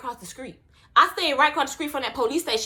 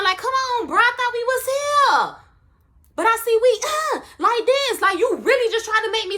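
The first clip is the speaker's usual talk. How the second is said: outraged disbelief; 9 semitones higher than usual. Real, not acted. Outraged at friend's betrayal.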